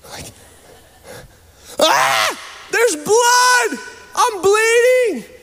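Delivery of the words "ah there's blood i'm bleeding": very high, hammed-up shriek; mock panicked scream. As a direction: scream in exaggerated panic at the blood. Acted panic at his bitten ear.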